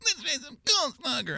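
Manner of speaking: put-on high, light girl's voice; squeaky girly voice. Imitating a girl.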